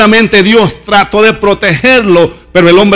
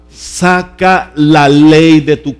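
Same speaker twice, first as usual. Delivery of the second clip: slow emphatic command; 2 semitones lower, much slower than usual. Shown as a demonstration. Preacher's key command, first time.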